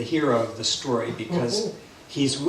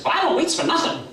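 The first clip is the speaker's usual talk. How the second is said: high, quick, boastful bird; Berchicken Hawk; 13 semitones higher, 4 dB louder, faster than usual. Acted. Hawk boasts to the patient buzzard, high cocky voice. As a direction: brag in a high squawky voice. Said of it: "Berchicken Hawk says".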